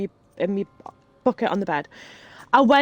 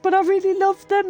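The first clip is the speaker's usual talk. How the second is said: put-on high, pleading little kid voice; whiny child plea; 10 semitones higher, 4 dB louder than usual. As her child self pleading with mum to keep the snails. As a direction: beg like a small child who loves her snails.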